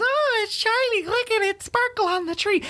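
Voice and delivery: put-on high, breathy child voice; dazzled child voice. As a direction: squeal like a child at the tree.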